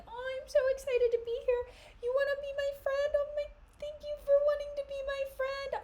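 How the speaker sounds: very high, eager puppy voice; high bouncy puppy-dog eager voice; golden retriever Cassio. Retold or imitated. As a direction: gush like an overexcited puppy. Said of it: "golden retriever energy".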